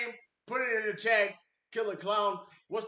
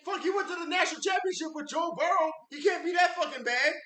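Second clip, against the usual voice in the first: heated shout; 8 semitones higher, faster than usual. Real, not acted. Heated defense of coach.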